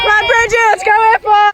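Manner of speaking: loud high cheer for a runner. Real, not acted. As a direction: yell encouragement from the sideline.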